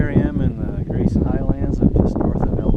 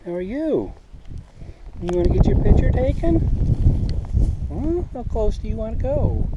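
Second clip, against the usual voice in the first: cooing, much higher pitched, sing-song; 6 semitones higher than usual. Real, not acted. Vlogger coos at a foal in high baby talk.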